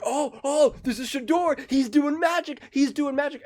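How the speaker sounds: high, hyped-up imitation of a cheering crowd; mock excited fans. Mocking the crowd cheering a scramble, mock excited and high. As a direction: gush like a starry-eyed fan, hammed up. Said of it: "They're like".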